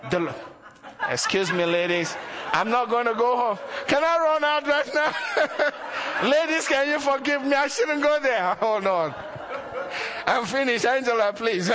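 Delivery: real laughter through an embarrassed apology; laughing. Real, not acted. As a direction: laugh while begging the ladies' pardon. Preacher caught laughing at slip.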